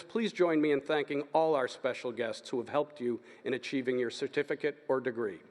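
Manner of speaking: usual speaking voice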